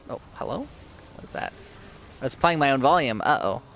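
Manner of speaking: ordinary talk, nothing marked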